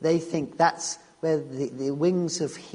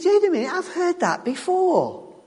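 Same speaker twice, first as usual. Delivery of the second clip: much higher, startled double take; sudden realisation; 12 semitones higher than usual. Retold or imitated. Disciple realising what Jesus said, startled and high. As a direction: catch yourself mid-thought. Disciple's thoughts voiced by preacher.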